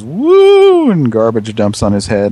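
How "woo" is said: very high, loud ghostly woo; spooky woo. Retold or imitated. Imitating a haunted trash bag, ghostly woo.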